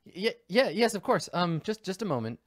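high, hesitant stammering assent; flustered woman on phone. Acted. Resident answers surprise inspector, high flustered voice. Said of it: "So she's like".

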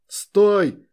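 sharp loud yell to halt; shouting orders over battle. Acted. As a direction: stop the deserters with a shout. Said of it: "закричал".

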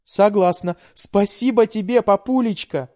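high, loud gushing thanks; delighted. A narrator's reading. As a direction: gush with delight. Delighted daughter thanks father.

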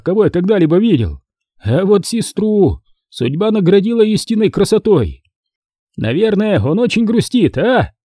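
soldier voice. Acted.

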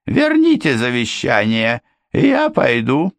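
high, insistent; demanding housekeeper. A narrator's reading. Housekeeper demanding the will from Ford, high insistent.

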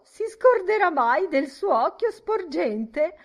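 high, loud hilarious shriek; shrieking cry. A narrator's reading. Miss Kennedy teasing about the bulging eye, shrieking. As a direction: cry it out in giggling horror. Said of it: "Miss Kennedy gridò".

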